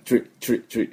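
チュリ as one quick "chree" syllable; short repeated "tree" bursts for チュリ, no vowel after the t; English "tri" sound. Shown as a demonstration. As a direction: say チュリ with t and r fused into "chr". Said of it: "鳥ではありません".

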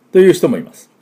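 normal talking voice

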